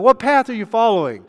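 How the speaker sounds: normal talking voice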